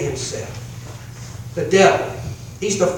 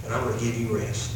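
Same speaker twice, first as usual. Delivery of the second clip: gentle invitation; 4 semitones lower, 6 dB quieter, faster than usual. Acted. Gentle voice of truth quoted.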